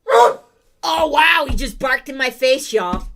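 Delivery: loud startled exclamation; jolted by a sudden bark; surprised. Real, not acted. Dog just barked in face.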